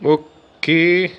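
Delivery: a long satisfied اوكي; drawn-out okay. Real, not acted. Reacting to a goal.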